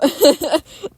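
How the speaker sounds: high incredulous laugh. Real, not acted. Laughing at the film's absurdity.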